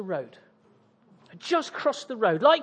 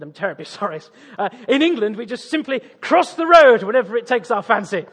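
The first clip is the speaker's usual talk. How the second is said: hammed-up British politeness, swelling louder; exaggerated British accent; 7 dB louder than usual. Tourist excusing himself to an officer, exaggerated British accent. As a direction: lay the Britishness on thick. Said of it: "I'll just crank up the Britishness".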